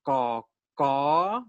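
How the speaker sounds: long "ko", then a rising "ko"; slow model pronunciation, second syllable sweeping up; demonstrated syllables. Shown as a demonstration. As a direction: draw out each "ko" separately. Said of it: "when you pronounce a word like".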